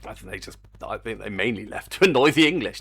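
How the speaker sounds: normal talking voice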